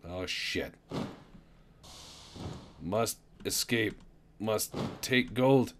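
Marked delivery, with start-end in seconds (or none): 2.8-5.7 halting, one word at a time with pauses; gasping slow talk. Pretend. Gasping player short of oxygen, talking slow and halting. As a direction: play out of air, slow and broken. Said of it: "talk slow".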